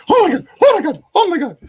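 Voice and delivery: mimicking his wife's panic, high and loud; horrified shriek. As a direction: shriek at the sight of blood. Wife sees the wound, shrieks.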